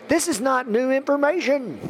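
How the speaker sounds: "this is not new information" pitched high throughout; emphatic insistence. Real, not acted. Preacher answering doubters about the Bible, emphatic. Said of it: "I say this with emphasis".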